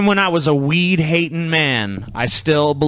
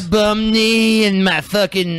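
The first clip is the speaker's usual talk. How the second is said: stoner voice; 5 semitones higher than usual. Retold or imitated. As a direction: play a spaced-out stoner. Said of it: "that's [name]'s old stoner voice".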